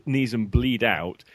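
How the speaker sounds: ordinary talk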